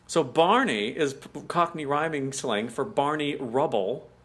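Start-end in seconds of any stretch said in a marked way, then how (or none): none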